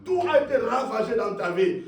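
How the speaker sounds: impassioned preacher proclaiming a promise; full-force preaching. Real, not acted. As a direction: declare it with rising fervour, then hammer the refrain.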